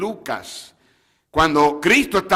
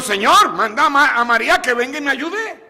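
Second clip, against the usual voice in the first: annoyed Martha; 7 semitones higher than usual. Retold or imitated. Martha complaining to Jesus about María, raised and annoyed. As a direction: whine it like an overworked sister. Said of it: "Marta se enojó".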